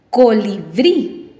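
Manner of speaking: "Colibrí" slowly, BRÍ emphasized; stress on last syllable. Shown as a demonstration. Teacher showing a word with final stress, "Colibrí" stressed on BRÍ. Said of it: "Esta lleva la fuerza de voz".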